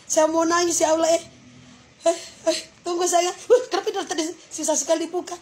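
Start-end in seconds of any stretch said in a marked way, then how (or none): none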